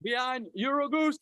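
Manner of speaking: high, ringing roll-call announcement; calling out names. Real, not acted. Organizer calling up partner organizations for applause, calling out. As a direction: proclaim each name high for applause.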